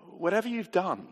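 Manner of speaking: normal talking voice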